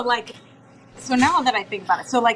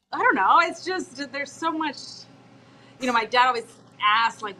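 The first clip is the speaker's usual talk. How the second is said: normal talking voice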